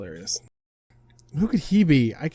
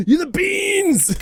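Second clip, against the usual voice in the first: very high, loud, drawn-out squeal; excited squeal; 6 dB louder, much slower than usual. Real, not acted. Player gushes over pink paw pads, excited squeal. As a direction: gush loudly over something adorable.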